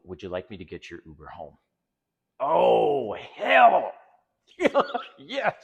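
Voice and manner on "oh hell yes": loud, high whoop of delight with a laugh; gleeful outburst. Real, not acted. Coach gloating about calling her an Uber, loud gleeful shout. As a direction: crow with triumph, laughing at the thought.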